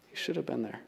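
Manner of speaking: a hushed echo of the line before; soft echo. Shown as a demonstration. Preacher letting the admission sink in, softly echoed.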